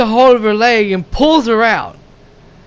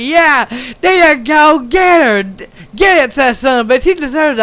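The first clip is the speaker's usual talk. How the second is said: high, excited egging-on; cheering her on; 5 semitones higher than usual. Retold or imitated. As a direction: re-enact rooting for the heroine. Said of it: "cheering her on".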